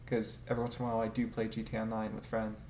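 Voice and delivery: ordinary talk, nothing marked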